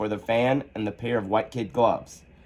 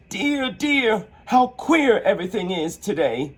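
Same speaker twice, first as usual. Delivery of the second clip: put-on high, wondering girl's voice; young Alice; 8 semitones higher than usual. Alice shrinking, high and wondering. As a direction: marvel at the strange feeling.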